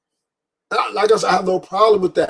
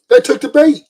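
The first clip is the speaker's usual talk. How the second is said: emphatic repeat; 7 semitones higher, 6 dB louder than usual. Real, not acted.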